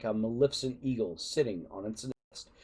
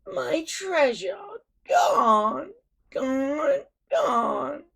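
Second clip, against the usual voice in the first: much higher, slow wailing, each gone louder and sobbing; sobbing lament; 14 semitones higher, 5 dB louder, much slower than usual. A narrator's reading. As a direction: mourn the lost treasure like a child. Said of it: "he cried".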